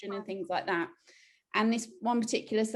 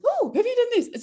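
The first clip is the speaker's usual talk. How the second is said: high, louder mock-eager question; eager nudge; 5 dB louder than usual. Imitating a friend's eager WhatsApp, high and loud. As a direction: chirp it like a keen friend's text.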